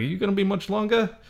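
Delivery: lightly voiced janitor, hurried and soft; janitor's question. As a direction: toss it off as the janitor. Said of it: "And the janitor's cleaning up. He's like".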